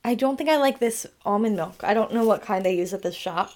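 normal talking voice

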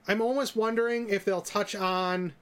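ordinary talk, nothing marked

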